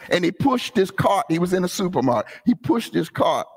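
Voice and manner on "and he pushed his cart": ordinary delivery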